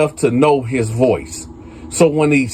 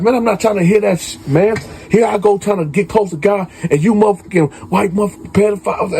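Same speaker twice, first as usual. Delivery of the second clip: high, rapid indignant outburst without shouting; retold angry rant; 7 semitones higher, faster than usual. K B berating the priest in a retold quarrel, angry rant. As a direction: act out an exasperated tirade, pitched up and rushed.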